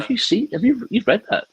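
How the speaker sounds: very high, incredulous retold question; high-pitched acted astonishment; mock surprise. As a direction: imitate someone amazed by an unusual sight. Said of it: "as if it was an unusual thing".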